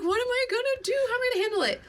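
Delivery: high, fretful self-imitation; mock panic. Retelling worry about handling cat's death, high mock panic. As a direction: pitch it way up in pretend panic.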